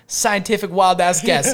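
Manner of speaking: quoting Mo. Retold or imitated. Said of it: "he's like".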